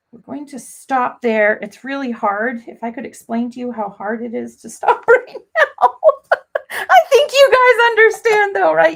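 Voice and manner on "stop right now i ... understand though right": high, loud words through laughter; laughing. Real, not acted. Crafter admitting to viewers she can't stop, laughing. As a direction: crack up while appealing to the viewers. Laughing at how hard stopping is.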